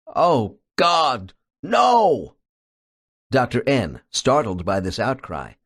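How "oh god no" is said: rising to a high, loud horrified cry on no; horrified exclamation. Acted. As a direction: gasp it out in sudden terror, peaking on the last word. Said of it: "with a loud, horrified exclamation".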